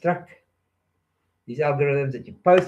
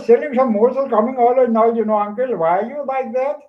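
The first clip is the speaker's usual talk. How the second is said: put-on a light Indian accent, higher and louder; 10 semitones higher, 6 dB louder than usual. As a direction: play a little Indian vendor with an Indian accent, pitched up. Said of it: "a little Indian man from India".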